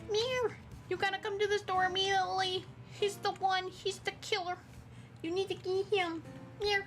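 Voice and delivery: high, urgent little cat voice; squeaky cat tattling; high cat voice telling on the killer; voicing the cat.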